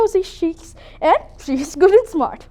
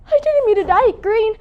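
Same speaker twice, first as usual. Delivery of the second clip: normal talking voice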